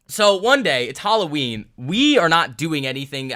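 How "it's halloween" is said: ordinary delivery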